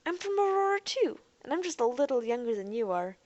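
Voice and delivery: high, loud, excited opening that eases off; bright. A narrator's reading. Girl telling Jeff they share a planet, bright. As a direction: pipe up eagerly at the shared home. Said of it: "she said brightly".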